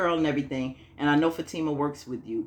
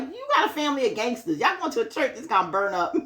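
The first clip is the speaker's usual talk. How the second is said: much higher, fast mocking jab, laughing at the end; mock [name] jab; 9 semitones higher, faster than usual. [name] teasing Sabrina about her gangster family, high and fast.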